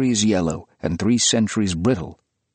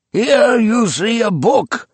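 high, loud, slow proclamation; excited old collector; 13 semitones higher, 6 dB louder, slower than usual. A narrator's reading. Old man to his gathered guests, high loud feverish. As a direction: show off the treasure with feverish glee.

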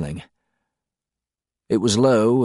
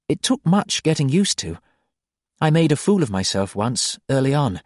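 lightly voiced woman, higher, amused; a woman gently breaking news, higher; wife explaining the new world, higher, amused; Ziri, higher voice; 7 semitones higher than usual. A narrator's reading.

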